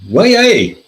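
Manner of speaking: high, loud Geordie "Why, aye?"; hammed-up Geordie Hartlepool man exclaiming; Geordie accent. Radio interviewee in Hartlepool, hammed-up Geordie accent. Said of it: "This is me doing a, a north and eastern".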